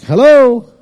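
loud, high call into the dark house; startled call to whoever is up; retold call, loud and high. Calling out in dark kitchen.

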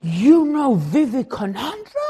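loud, slow, astonished question; freaking out. Retold or imitated. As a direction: act stunned, stretching each word out. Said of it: "The guy was freaking out".

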